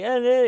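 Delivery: high mock-distraught "eh, eh"; pretend-distraught whimper. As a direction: whimper as if badly faking distress. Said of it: "the tone of pretending to be distraught".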